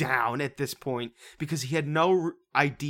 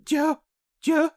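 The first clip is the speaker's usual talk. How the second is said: much higher, slow eerie question; mocking Emily; 13 semitones higher, 4 dB louder, slower than usual. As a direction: draw it out high and spooky. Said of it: "She goes".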